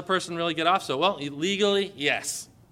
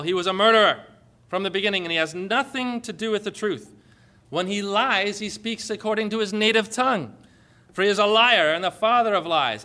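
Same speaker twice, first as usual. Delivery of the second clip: louder, higher preaching voice declaring the devil a liar; forceful sermon quote; preaching scripture; 3 semitones higher, 5 dB louder than usual. Acted. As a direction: proclaim it with full force.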